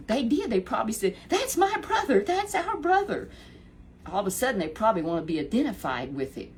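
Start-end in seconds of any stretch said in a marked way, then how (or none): 1.3-3.3 high, bragging brothers; proud brothers. Retold or imitated. David's brothers claim him after the victory, high and proud.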